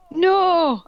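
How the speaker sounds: high, loud hammed-up scream; loud high mock scream; sexy scream. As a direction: belt out a melodramatic no. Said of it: "I screamed sexily".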